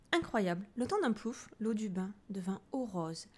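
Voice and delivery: ordinary delivery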